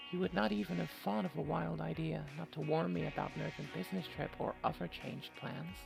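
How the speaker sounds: ordinary talk, nothing marked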